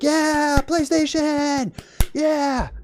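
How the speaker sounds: high, drawn-out fake crowd cheer; mock cheering. Everyone thrilled by a PlayStation animation, mock cheering. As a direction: cheer like an overjoyed crowd, hammed up.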